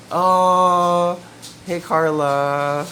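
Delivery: high and loud, delighted greeting to the dog; light up as your dog walks in. Real, not acted. Greeting her dog entering.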